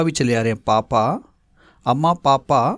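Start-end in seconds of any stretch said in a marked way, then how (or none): none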